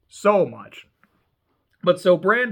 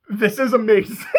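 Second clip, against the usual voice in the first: laughing; 4 semitones higher, 3 dB louder than usual. Real, not acted. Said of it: "I'm laughing so hard".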